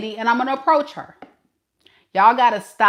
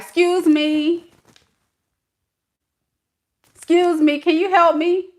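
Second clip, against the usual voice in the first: higher, drawn-out call for attention; mock frustrated; 5 semitones higher than usual. Shopper calling to onlookers for help, mock frustrated. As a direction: call for help with pointed, high-pitched politeness. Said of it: "I get so frustrated".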